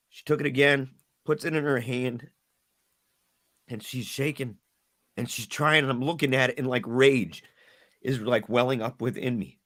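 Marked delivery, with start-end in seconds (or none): none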